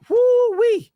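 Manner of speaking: high, loud whoop; excited whoop. Real, not acted. Kicking off the hornet's nest story with a loud whoop. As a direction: let out a big whoo-wee.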